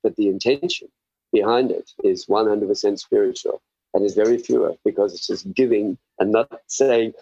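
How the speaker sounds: ordinary talk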